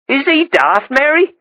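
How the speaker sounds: lightly voiced Norfolk girl; young girl. A narrator's reading. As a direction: tease with a girl's lilt. Jenny's cheeky question.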